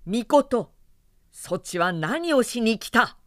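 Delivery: loud, stern rebuke; sharp scolding. Acted. Goddess confronting her brother, stern loud rebuke. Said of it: "いきなりお叱りつけになりました".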